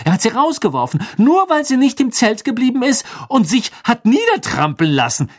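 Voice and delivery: high, heated tirade; worked up. A narrator's reading. Hermine rages about why Crouch fired Winky, indignant. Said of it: "regte sich Hermine auf".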